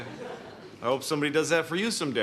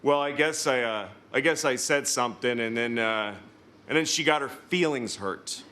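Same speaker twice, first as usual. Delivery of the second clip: low, hesitant friend's excuse; imitated friend fumbling to explain; imitating friend; 6 semitones lower than usual. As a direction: hedge through a lame excuse, pitched down. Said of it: "He goes".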